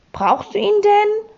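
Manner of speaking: tentative question in a high voice; cautious. A narrator's reading. Sams asks whether he needs the alarm clock, cautious. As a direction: ask it carefully, pitched well up. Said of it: "fragte es vorsichtig".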